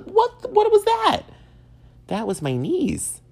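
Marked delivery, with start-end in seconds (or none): 0.0-1.2 much higher, louder alarmed squeal; startled. Retold or imitated. Thinking a bottle fell off the fridge, a startled high squeal. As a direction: jump at a sudden crack behind you.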